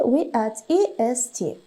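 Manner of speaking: ordinary talk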